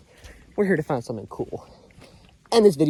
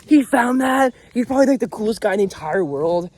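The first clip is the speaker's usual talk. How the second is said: impressed fan; 5 semitones higher, 5 dB louder than usual. Retold or imitated. Viewers imagined praising him.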